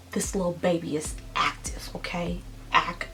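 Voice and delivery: soft confiding whisper. Acted. Mom telling viewers about her active baby, playful whisper. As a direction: lean in and whisper it like a secret.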